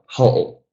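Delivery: "ho'o" stressed on HO, ending swallowed; stress on first syllable. Shown as a demonstration. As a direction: lean on the HO of "ho'o". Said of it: "stress the first out of two syllables".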